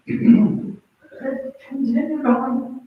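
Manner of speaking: usual speaking voice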